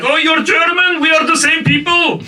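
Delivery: loud, high mock-enthusiastic cry; excited welcome. Iranians hailing a visiting German as kin, excited. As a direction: exclaim it like an overjoyed fan, high and loud. Iranians greet him with delighted recognition.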